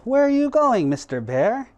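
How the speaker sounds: high, bright crow voice; lightly voiced crow. Crow calls out to the passing bear.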